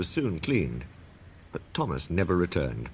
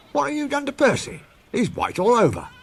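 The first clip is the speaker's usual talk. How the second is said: much higher, startled signalman; shocked signalman. A narrator's reading. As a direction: blurt it out in shock.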